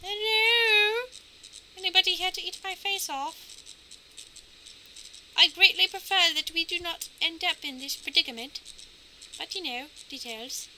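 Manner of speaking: high, put-on little voice; mock timid calling. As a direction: call out sweetly to whatever lurks, in falsetto. Mock calling out to lurking aliens.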